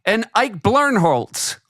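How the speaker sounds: high, drawn-out mock presenter call; botched name announcement. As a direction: sail confidently into a fumbled name. Acting out blowing the follow-up name.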